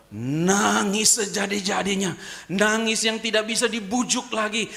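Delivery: high, loud preaching at full force; fiery preaching. Acted. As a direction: preach it out to the congregation. (khotbah berapi-api, tinggi dan keras)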